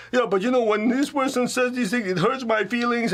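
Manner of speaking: mock-whiny complaint; hurt whiny voice. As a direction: whine it like someone wounded by words. Voicing a hurt listener.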